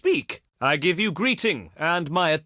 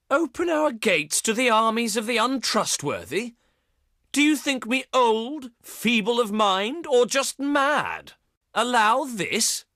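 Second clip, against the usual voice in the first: higher-pitched, theatrical incredulity; indignant outrage; 6 semitones higher than usual. Acted. Leader asked to open the gates to untrustworthy armies, indignant. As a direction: throw the questions back in offended disbelief. Outraged refusal of envoy's demand.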